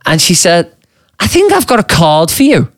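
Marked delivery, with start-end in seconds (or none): 1.2-2.7 lightly voiced barmaid, pitched up; barmaid's voice. Barmaid tells the birthday lad about a card, lightly voiced higher. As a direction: mimic the barmaid a little higher. Barmaid acted in retold dialogue.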